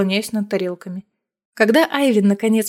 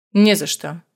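curt reply; 5 semitones lower than usual. A narrator's reading. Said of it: "резко отвечает он".

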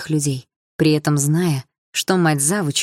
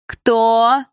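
louder, higher jeering "Кто?"; louder sneering "Кто?"; mocking tone; 7 semitones higher, 6 dB louder than usual. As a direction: throw the word at her as mockery. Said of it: "издевательским тоном".

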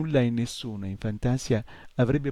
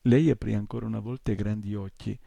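plain reading voice

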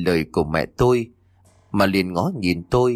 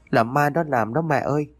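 higher pleading sob, "nó" leaned on; crying child, higher; 7 semitones higher than usual. A narrator's reading. Boy clinging to his mother, crying higher plea. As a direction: plead through tears, pitch climbing. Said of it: "vừa khóc vừa mếu máo".

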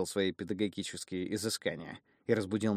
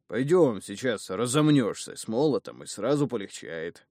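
hearty urging; 6 dB louder than usual. Acted. Father cheerfully drags son.

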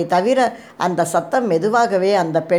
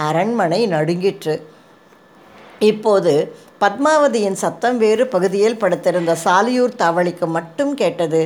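plain reading voice

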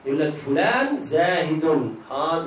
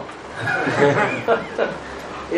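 breathy laugh; 5 semitones lower than usual. Real, not acted. Laughing at the joke.

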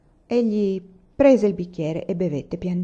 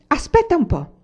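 higher, louder menacing shout; rising threat; 9 semitones higher, 5 dB louder than usual. A narrator's reading. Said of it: "gridò zio Pera". (minaccia che sale di tono)

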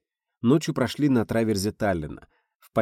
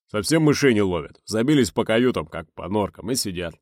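gruff boatswain; 6 dB louder than usual. Acted. Grumpy boatswain voiced.

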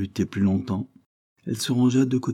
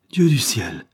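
a sharp, louder gasp of horror; horrified cry; 2 semitones higher, 4 dB louder, slower than usual. A narrator's reading. Cornélie recoils from the dying woman, horrified cry. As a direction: recoil as if from a ghost. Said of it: "s'écria ... épouvantée".

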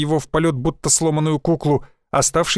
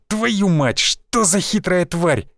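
frustrated curse; 4 semitones higher, much slower than usual. Acted.

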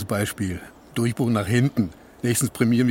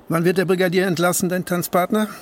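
sharp challenge; 7 semitones higher, 4 dB louder than usual. Acted. Pointed challenge to party man.

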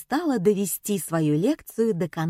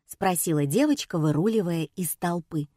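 usual speaking voice

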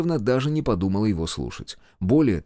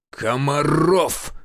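slow, drawn-out, higher-pitched bellow; roared name; 5 semitones higher, much slower than usual. A narrator's reading. Official summons the major through the phone, roaring. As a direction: roar the surname with rolled force. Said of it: "вновь рявкнул динамик телефона".

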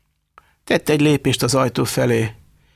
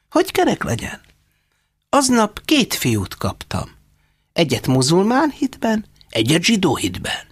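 put-on higher old woman's voice; Róza mama; 9 semitones higher than usual. A narrator's reading. (magasabb hangú idős asszony)

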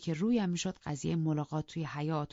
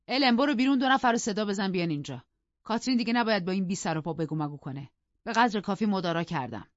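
loud, higher burst easing into firm anger; commanding, indignant master; angry order; 4 semitones higher, 5 dB louder than usual. Acted. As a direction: order the servant out, fed up.

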